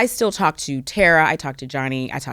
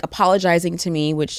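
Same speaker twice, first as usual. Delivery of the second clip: ordinary delivery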